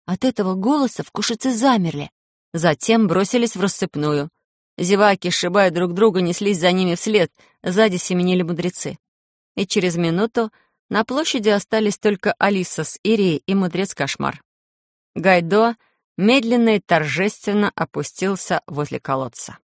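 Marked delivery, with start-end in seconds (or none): none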